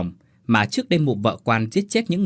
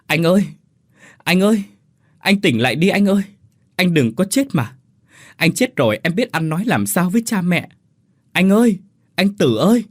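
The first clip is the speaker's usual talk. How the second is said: high, loud, desperate pleading; acted wail of a brother begging the other to wake; wailing cry; 7 semitones higher, 4 dB louder than usual. A narrator's reading. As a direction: cry out in high-pitched anguish. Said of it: "kêu tiếng kêu của thằng Tế như gào khóc".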